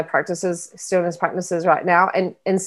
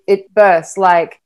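ordinary talk, nothing marked

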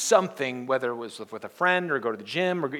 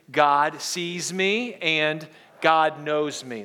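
slow, deliberate, a bit louder; slow group declaration; 4 dB louder, much slower than usual. Shown as a demonstration. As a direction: lead the congregation through it slowly. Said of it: "Let's say it, the first one".

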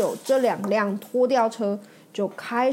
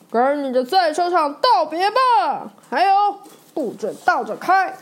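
higher, louder bullying yell; taunting shout; 7 semitones higher, 5 dB louder than usual. Acted. Bob shouting a taunt at Mater before the race. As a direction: bark a threat at the rival. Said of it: "巴布对着脱线大喊".

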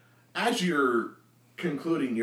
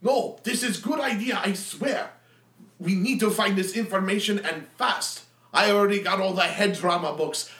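louder, higher brash warrior rant; raving Minsk; 7 semitones higher, 5 dB louder than usual. Acted. Minsk rants about killing the deacon, loud building. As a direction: rant wildly, building to an oath.

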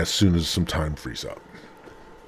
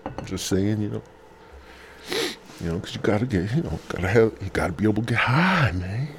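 normal talking voice

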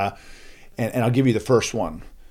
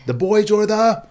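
high, loud, stretched-out rallying call; contest host call; 11 semitones higher, 6 dB louder, slower than usual. Retold or imitated. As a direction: pitch it to the crowd, left hanging. Calls teams as contest host.

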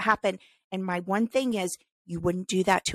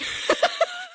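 high burst of laughter. Real, not acted. Laughs mid sentence.

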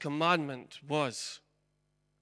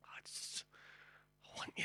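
unvoiced whisper, much softer; heavenly Father whispering; 15 dB quieter than usual. Heavenly Father grieving over a lost child, whispered. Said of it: "a heavenly Father would say".

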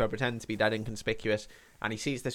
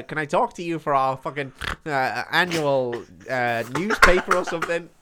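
nerd voice; 2 semitones higher, 5 dB louder than usual. Retold or imitated. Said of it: "I can't deal with his voice at all".

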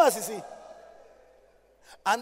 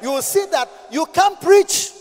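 loud, high preacher's cry, slow on the last words; shouted preaching; 7 semitones higher, 13 dB louder than usual. Acted. Pastor warning of intimidators, shouted preaching. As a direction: thunder it at the congregation.

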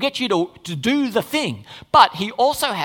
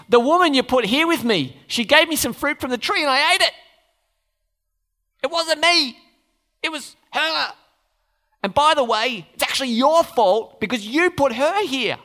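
whiny blaming Adam; 7 semitones higher than usual. Retold or imitated. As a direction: shift the blame like a caught child. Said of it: "He says".

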